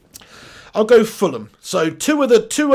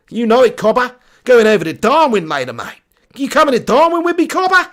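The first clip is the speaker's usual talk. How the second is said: mock Australian accent; 3 semitones higher, 4 dB louder than usual. Streamer inviting a viewer to Darwin, mock Australian accent. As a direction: play the matey Aussie, pitch rising.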